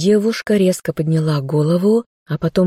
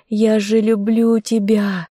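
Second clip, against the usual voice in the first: higher, slow tearful confession; sobbing; 5 semitones higher than usual. Acted. Girl sobs her love to the dead bear. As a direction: sob out the words of love. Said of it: "всхлипнула девушка".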